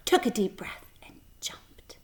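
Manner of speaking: breath drops to a whisper on the jump; hushed whisper. A narrator's reading. Narrator building the swing jump, whispered. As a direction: hold the breath, then whisper it.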